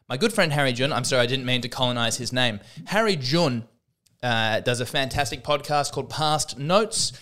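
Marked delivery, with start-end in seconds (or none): none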